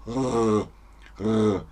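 R as a long, buzzing throat sound; sustained voiced R alone. Shown as a demonstration. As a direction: hum through a rolled throaty R. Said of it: "Das hier unten ist stimmhaft".

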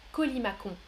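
Colimacon with a hard K before the final on, kolimakon. Shown as a demonstration. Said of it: "Je prononce trois, euh, sons différents". (Colimacon prononcé avec un K, colimakon)

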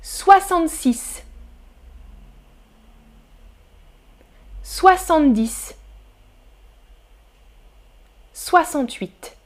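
soixante-six, soixante-dix, soixante-huit each said alone after a long pause; listening quiz read. Shown as a demonstration. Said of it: "écoutez". (lecture lente des options, avec des pauses)

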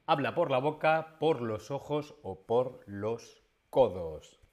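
ordinary talk

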